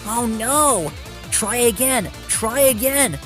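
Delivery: high, dismayed urging; urgent shout. A narrator's reading. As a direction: call out for another attempt. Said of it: "shouted Thomas".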